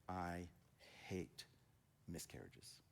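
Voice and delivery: low, soft and halting, as if holding back tears; subdued imitation of a tearful confession; retold near tears. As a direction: retell it quietly, heavily, with pauses between words. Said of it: "getting tears, and he's like".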